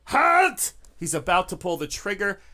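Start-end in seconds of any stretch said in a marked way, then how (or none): 0.1-0.7 high, very loud shout; gunman's high-pitched screamed order; screamed command. Acted. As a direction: yell it to stop an armed attacker. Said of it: "he stops screaming".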